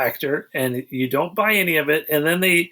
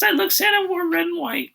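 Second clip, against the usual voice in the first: sarcastic correction; 12 semitones higher than usual. Real, not acted. Mocking the Santa question.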